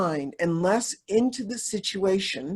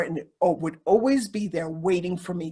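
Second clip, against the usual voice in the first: ordinary talk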